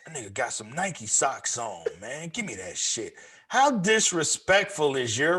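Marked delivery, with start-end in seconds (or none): 0.1-3.1 low mock thief mutter. Imitating the sock thief.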